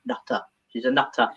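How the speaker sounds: low, soft disbelief; retold boy's aside; muttered aside. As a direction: mutter it under the breath like a wary child. Boy's aside about the therapist.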